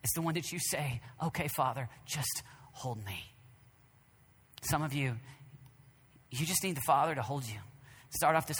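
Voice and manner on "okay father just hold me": slow, soft whispered prayer; hushed, slow plea; pleading whisper. Acted. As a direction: whisper like a child asking to be held. Child's prayer to father.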